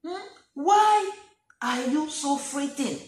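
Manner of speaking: loud, slow rebuke; Jesus rebuking. Acted. Jesus rebuking frightened disciples in the boat, loud and slow. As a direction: scold them for their fear. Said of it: "So Jesus tells them".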